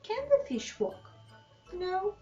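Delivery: ordinary delivery, nothing marked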